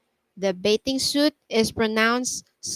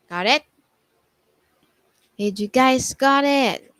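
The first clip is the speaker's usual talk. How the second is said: usual speaking voice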